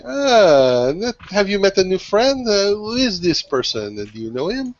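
lilting, high-swooping acted greeting; feigned friendly inquiry. Crewmate handing Nathaniel a drink, lightly feigned curiosity. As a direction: swoop up with mock innocent curiosity.

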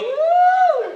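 loud, very high woo; imitated girl's whoop. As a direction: whoop like a girl in the crowd. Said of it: "A girl go".

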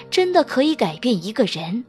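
ordinary delivery, nothing marked

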